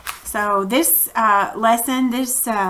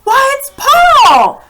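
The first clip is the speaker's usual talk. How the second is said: high, loud, slow shout; shouting crowd; 10 dB louder, slower than usual. Acted. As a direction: shout it in amazement. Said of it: "the shouts went up".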